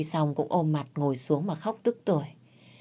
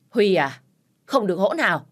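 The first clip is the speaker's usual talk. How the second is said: loud, raised rebuke; stern shout; 4 semitones higher, 3 dB louder than usual. Acted. Father-in-law shouting at his rude son, a loud stern rebuke. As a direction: bark the warning at him. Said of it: "Ba chồng tôi quát Huy".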